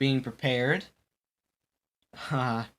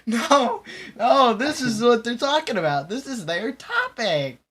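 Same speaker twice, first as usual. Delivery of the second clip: excited; 10 semitones higher, 7 dB louder than usual. Real, not acted. Defending the screams, still hyped.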